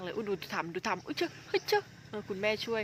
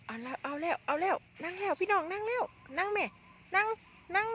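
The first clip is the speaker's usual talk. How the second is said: rising excitement, calling it high and loud like a commentator; excited; 10 semitones higher, 4 dB louder than usual. Real, not acted. Vlogger hyping the mother animal sitting down, excited high call. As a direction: cheer the moment it finally sits down.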